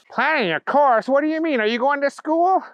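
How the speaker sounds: high-pitched dim questioning; quizzical, high character voice; puzzled Booger. A narrator's reading. Booger quizzing the bunny about planning a course, high and puzzled.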